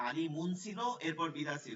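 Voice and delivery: normal talking voice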